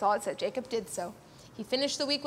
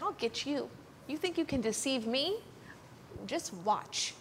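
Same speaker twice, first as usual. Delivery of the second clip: soft, understated menace; mad; 8 dB quieter than usual. Acted. Jacob vowing to get back at Laban, quietly mad. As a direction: act quietly vengeful, holding the anger down. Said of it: "He was really mad".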